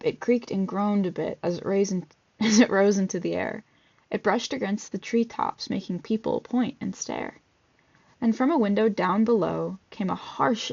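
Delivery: ordinary delivery, nothing marked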